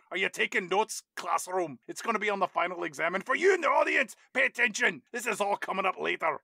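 mock-Scottish teacher rousing the class; pointed lecture in a put-on Scottish accent; Gerard Butler's Scottish accent. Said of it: "Legitimate Scottish accents happening".

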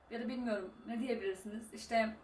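usual speaking voice

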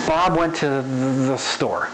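slow, dragging out "the" before "store"; slow reading with a stretched "the"; lingering. Shown as a demonstration. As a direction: linger on "the", holding back "store". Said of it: "where you linger".

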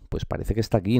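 ordinary talk, nothing marked

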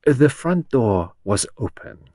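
deliberate, courteous Belgian character voice; Poirot's accented voice. A narrator's reading. Poirot announces himself to Henrietta, slow and accented.

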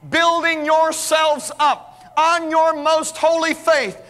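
slow, drawn-out reading of the verse; solemn scripture reading. Acted. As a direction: read it slowly like a sacred text.

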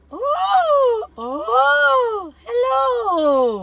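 high shouted "hello" voice test; lost-voice trick. Shown as a demonstration. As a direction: squeeze out a high loud hello. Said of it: "my friend Debbie said, you go".

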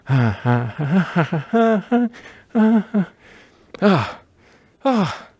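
panting ahs, out of breath. Real, not acted. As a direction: gasp between moves, out of breath. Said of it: "God, I'm out of breath".

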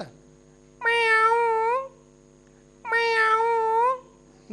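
high, long-held meow; cat meow. Acted. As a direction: imitate a cat calling with a drawn-out meow. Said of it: "ಒಂದು ಬೆಕ್ಕು ಆಚೆ ಕಡೆ ಕೂತ್ಕೊಂಡು ಹೇಳ್ತಿತ್ತು".

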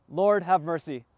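ordinary talk, nothing marked

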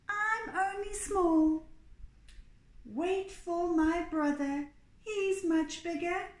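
high, slow small goat voice; pleading little goat. A narrator's reading. Little goat sending troll to his brother, high pleading voice.

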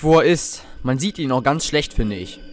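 ordinary delivery

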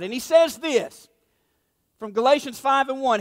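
ordinary talk

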